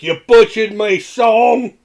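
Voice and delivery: put-on high, loud, slow New England accent. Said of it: "That's just my, uh, New England, England accent".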